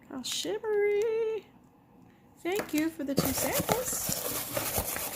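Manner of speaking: high, slow, delighted coo. Real, not acted. Cooing over shimmer.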